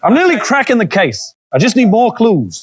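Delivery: film noir detective voice; hardboiled sixties American; American accent. Acted. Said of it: "it's sixties American".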